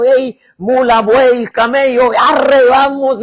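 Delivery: loud, slow herding calls; a drover shouting at his animals; calling out to livestock. Acted. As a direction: drive the mules and camels along. Herding animals toward Sodoma.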